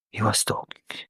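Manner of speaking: breathy whispered aside. Real, not acted. Telling the audience about the long voice note, whispered. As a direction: drop to a whisper for the punch.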